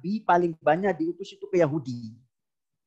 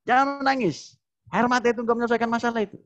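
high-pitched, rushed mock scolding; dismissive husband; 7 semitones higher, faster than usual. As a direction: imitate a husband impatient with his wife's tears. Imitates husband dismissing crying wife. (meniru suami mengomel, nada tinggi dan cepat)

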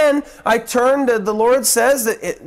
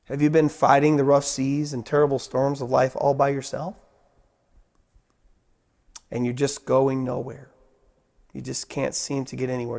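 intimate altar call; 7 semitones lower than usual. Acted. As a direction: draw the listener in gently.

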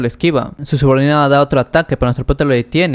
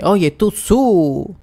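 higher, drawn-out calling out; mock yell, stretching each word without real volume; acted angry shout; 5 semitones higher, much slower than usual. Father challenges Su after flipping a table, acted angry shout. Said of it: "grita bien fuerte".

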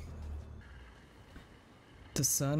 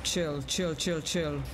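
ordinary talk

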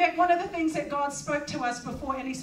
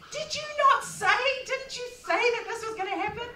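pleading complaint; 8 semitones higher than usual. Retold or imitated. Retold complaint to God, high.

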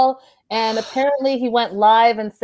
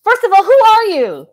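indignant; 9 semitones higher, 5 dB louder than usual. Real, not acted. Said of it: "indignant about a commenter".

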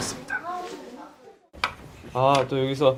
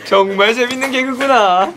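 slow, loud, high exaggerated praise; mock self-praise; 10 semitones higher, 8 dB louder, slower than usual. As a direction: ham up praise for your own pun. Mock praise for own joke. (과장된 자화자찬)

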